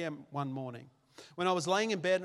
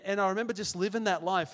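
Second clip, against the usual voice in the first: ordinary talk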